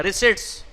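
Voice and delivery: रिसिप्ट्स as ri-sits, no p; silent p. Shown as a demonstration. Teacher drilling students, रिसिप्ट्स with silent p. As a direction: drop the p in रिसिप्ट्स. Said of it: "इसमें पी silent होता है".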